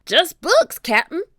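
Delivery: high, loud deckhand's shout; Stinky. A narrator's reading. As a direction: shout a report down to the captain. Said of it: "shouted Stinky".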